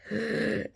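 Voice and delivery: demonstrated sharp gasp; indrawn breath. Shown as a demonstration. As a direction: suck air in over a burning tongue. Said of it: "draw in a breath".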